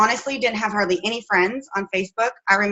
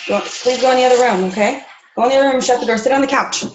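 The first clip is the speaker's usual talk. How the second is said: firm mom instructions; 3 semitones higher, faster than usual. Real, not acted. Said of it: "that's how I parent around here".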